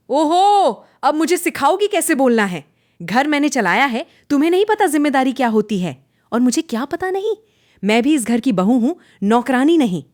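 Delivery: high, loud shouting back and forth; heated quarrel. A narrator's reading. Mother-in-law and daughter-in-law quarrelling, both shouting high and loud. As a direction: fight back at full voice. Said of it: "बहू की आवाज़ भी ऊंची हो गई".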